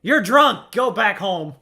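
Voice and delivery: mock heckle. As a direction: shout him off like a rowdy fan. Heckling the game character.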